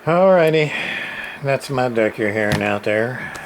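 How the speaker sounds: usual speaking voice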